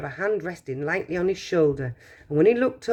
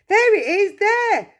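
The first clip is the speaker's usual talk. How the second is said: much higher, louder shout; 6 dB louder, slower than usual. Acted. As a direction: point and shout it out. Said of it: "someone else shouted".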